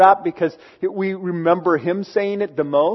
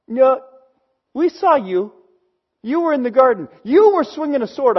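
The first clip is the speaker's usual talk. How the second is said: higher-pitched mock accuser; retold accusation; 6 semitones higher than usual. Bystanders confronting Peter over the garden, accusing. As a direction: insist on it, pointing the finger.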